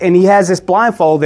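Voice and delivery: usual speaking voice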